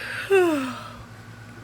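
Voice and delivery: long open-mouthed yawn; a real sleepy yawn. Real, not acted. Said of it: "there's your yawn for the day".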